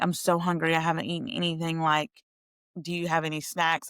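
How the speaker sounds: ordinary talk, nothing marked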